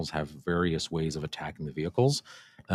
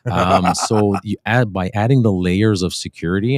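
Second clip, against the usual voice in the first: radio voice; 2 semitones higher, 11 dB louder than usual. Real, not acted. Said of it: "now you sound like a radio guy".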